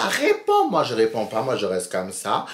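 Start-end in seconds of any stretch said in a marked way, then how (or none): 0.0-0.7 high, sharp shrill demand climbing on each word; angry insult. Retold or imitated. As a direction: spit out the insult, then demand an answer higher. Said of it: "elle fait des grands gestes comme ça".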